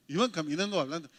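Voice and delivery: ordinary talk, nothing marked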